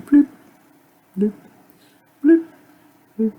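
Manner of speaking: short popping mouth bloops, spaced out; raindrop sound. Retold or imitated. Walking in the rain, imitating drops with popping bloops. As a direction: pop each drop like rain starting.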